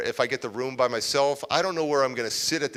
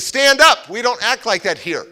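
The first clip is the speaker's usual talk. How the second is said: sharp command; 9 semitones higher, 11 dB louder than usual. Retold or imitated. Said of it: "she goes".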